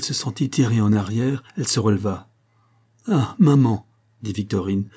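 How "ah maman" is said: put-on higher, louder child's exclamation; a daughter's heartfelt outburst; emotional cry. A narrator's reading. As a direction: cry out to mother with feeling. Daughter cries out to her mother.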